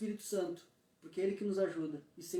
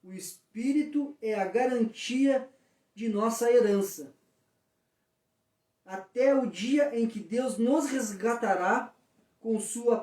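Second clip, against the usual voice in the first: slow, emphatic Bible reading, pitched up; scripture reading; 2 semitones higher, 5 dB louder, slower than usual. Real, not acted. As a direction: read the verse out loud, measured and weighty. Reads a Bible verse aloud. (leitura bíblica solene, pausada e enfática)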